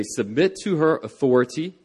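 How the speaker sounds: ordinary delivery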